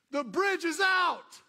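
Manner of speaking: loud, high, slow warning scream; crying man shouting a warning; desperate shout. Acted. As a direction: scream the warning with all your might.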